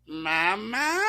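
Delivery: put-on high, questioning baby-creature voice; put-on high infant voice; mutant turtle baby. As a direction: imitate a big baby asking for its mother. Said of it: "and Tokka goes".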